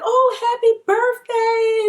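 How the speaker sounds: high, slow, sing-song birthday wish; mom's sing-song voice. Retold or imitated. Mom wishing her daughter happy birthday, high sing-song. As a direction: sweetly chirp it up high like a doting mom. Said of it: "she was like".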